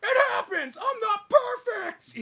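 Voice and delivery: very high, loud wail; hammed-up tearful self-defence; mock anguished confession. Acts out driver's wailing confession.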